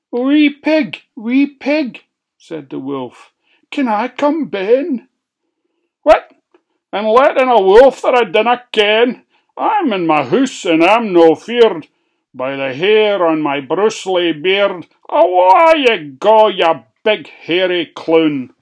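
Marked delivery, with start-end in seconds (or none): 0.1-2.0 high, loud coaxing call; wolf calling. Acted. The wolf coaxing the pig at the window, high and loud. 3.7-5.1 high, loud coaxing call; wolf calling. Acted. The wolf coaxing the pig at the window, high and loud. 6.0-18.5 high, loud scornful yell; defiant shout. Acted. The pig shouting defiantly at the wolf outside.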